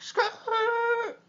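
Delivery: high, loud imitated tire skid; imitated car skid; tire screech. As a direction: screech it like brakes locking. Cars stopping short in traffic.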